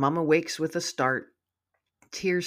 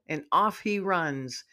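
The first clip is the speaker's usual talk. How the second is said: plain reading voice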